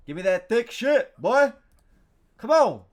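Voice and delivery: very loud, high demand; excited yelling. Real, not acted. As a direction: yell at the game to deliver. Yelling at the game for item.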